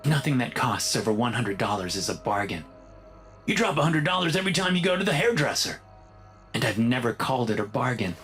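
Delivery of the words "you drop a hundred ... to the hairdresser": high, fast bickering comeback; quick, raised-pitch marital protest; defensive retort. Acted. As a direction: fire back like an indignant husband. Husband argues back at wife.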